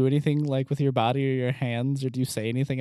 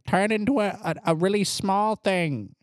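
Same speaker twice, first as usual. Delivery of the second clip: high squeaky familiar; 8 semitones higher than usual. Acted.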